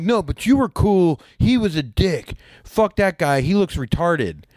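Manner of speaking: high-pitched, heated mock argument; retold insult. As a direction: act out an opponent lashing out. Golfer insults friend in retold quarrel.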